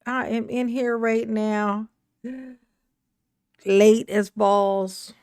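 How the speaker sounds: normal talking voice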